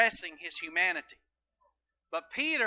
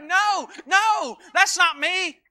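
loud, high protest; mock loud denial; 10 semitones higher, 10 dB louder, much slower than usual. Peter to the accuser, loud denial. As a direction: pastor voicing Peter's frantic denial, shouted.

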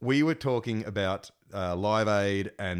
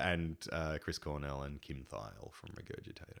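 normal talking voice

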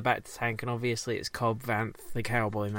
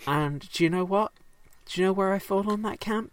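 teasing question; 8 semitones higher, 4 dB louder than usual. Real, not acted. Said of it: "teasing rhetorical question".

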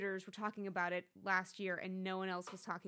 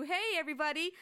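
cheery group greeting; 7 semitones higher, 6 dB louder than usual. Retold or imitated. Mock pep talk to the group.

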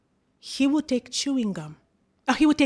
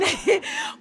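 1 short laugh. Real, not acted. Laughs at her own story.

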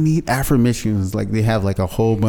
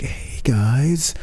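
breathy whispered greeting; hushed soothing hey guys; Stuart Smalley whisper; 3 semitones higher, 4 dB quieter than usual. Shown as a demonstration. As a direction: whisper it like a gentle self-help guru. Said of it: "I could talk like this all day".

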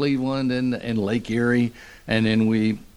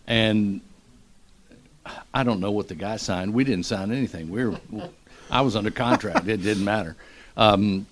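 ordinary talk

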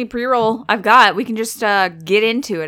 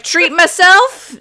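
treat-yourself voice; 7 semitones higher, 7 dB louder, much slower than usual. Retold or imitated. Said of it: "and I was like".

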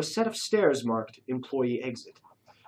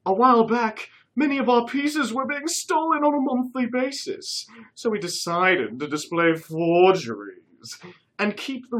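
high loud put-on British voice; fake British accent; 7 semitones higher, 5 dB louder than usual. A narrator's reading. Curtis explaining forgeries to Jacques and Dave, fake British accent. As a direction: explain breezily as the posh toff. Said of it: "he said".

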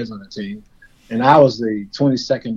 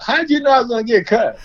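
whiny friend; 11 semitones higher, 3 dB louder than usual. Retold or imitated. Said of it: "he comes out".

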